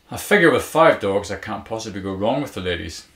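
loud, confident flirty banter. A narrator's reading. Man flirting with a woman he met, confident loud banter. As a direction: boast it boldly and playfully. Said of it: "growing more confident with his banter".

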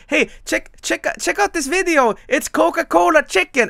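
chirpy "check this out" voice; eager show-off. Retold or imitated. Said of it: "I was trying to show my family, like".

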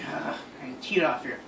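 breathy whisper under the breath; whispered aside. Real, not acted. Aside to friends about losing temper, whispered. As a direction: slip it in quietly.

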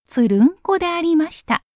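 usual speaking voice

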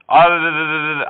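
long trilled R; rolled R attempt. Shown as a demonstration. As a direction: have a go at rolling the R. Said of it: "Can you help me roll the R's?".

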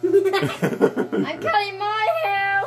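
laughing shout. Real, not acted. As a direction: shout it while cracking up.

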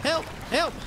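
panicked high-pitched shrieks; scared horror game streamer. Real, not acted. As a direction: yelp for help in real panic. Shark appears in the water.

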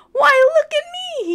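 high, loud thrilled dragon voice; a small dragon amazed at himself; excited little dragon. Acted. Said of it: "said Dexter".

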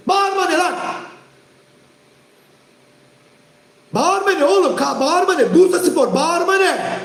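high, insistent repeated demand; angry demand. Real, not acted. Streamer demanding an answer from chat, angry and high. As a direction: hammer the question at them.

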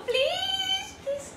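very high, drawn-out begging; whining plea. Acted. Late student begging a driver, whiny high plea. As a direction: wail one long please.

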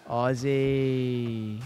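slow, drawn-out mock Australian "Aussie"; mock Aussie accent. Streamer tries "Aussie" in a mock Australian accent. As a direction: stretch "Aussie" in a hammed-up Australian vowel. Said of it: "Your fucking Aussie accent".

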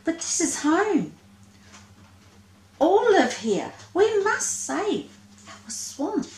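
high squeaky little monster, pleading; a tiny monster begging to stay home; small creature voice. Acted. Lesser Spotted Monster pleads.

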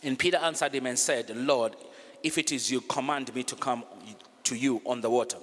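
steady, measured Bible reading; reading the Bible passage aloud; scripture reading. Acted. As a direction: read the passage out evenly to the congregation. Reads Matthew fourteen aloud.

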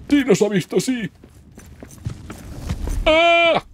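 high, loud panicked yelling; being chased. Real, not acted. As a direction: yell while fleeing. Troll chasing, yelling at it.

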